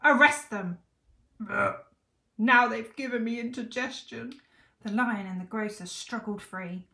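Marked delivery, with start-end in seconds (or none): 0.0-0.8 loud, raised royal command; bellowed order. A narrator's reading. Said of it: "bellowed the fat king". 1.5-4.4 loud, higher, put-out king; disgusted grumble. A narrator's reading. Fat king complains after bellowing.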